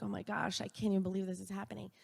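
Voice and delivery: hushed, quick gasp of disbelief, as if to herself; stunned disbelief. Retold or imitated. Mother seeing spent tear gas shells, hushed quick disbelief. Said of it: "And I'm like".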